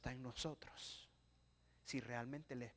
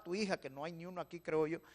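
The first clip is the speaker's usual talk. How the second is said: usual speaking voice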